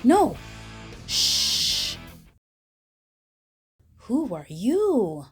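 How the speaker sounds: plain reading voice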